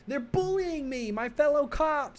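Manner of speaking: high-pitched whining plea, slow; whiny pleading. Acted. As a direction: whine at them to stop like a hurt kid. Officer whining to dispatcher.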